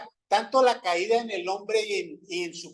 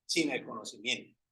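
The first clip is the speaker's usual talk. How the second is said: soft, dropped repeat of sin el conocimiento; quiet echo; 6 semitones lower, 11 dB quieter than usual. Shown as a demonstration. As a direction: let sin el conocimiento fall away into a hush. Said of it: "uno tiende a juzgar sin el conocimiento".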